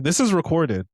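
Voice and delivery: high, loud pointed comeback; mock deadpan retort. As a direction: cut her off with the fact. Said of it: "he was like".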